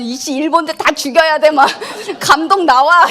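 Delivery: hammed-up furious football fan, laughing through it; mock angry fan. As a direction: play an outraged fan yelling at the screen, half laughing. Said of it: "막 얘기하잖아요".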